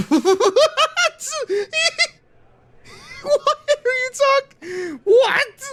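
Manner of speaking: high squeaky laughing what; laughing disbelief. Real, not acted. Streamer to chat about Cena's line, laughing in high disbelief.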